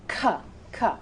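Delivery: "k" as a short "kuh"; K sound. Shown as a demonstration. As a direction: give the sound of "k", separately twice. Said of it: "The K sound".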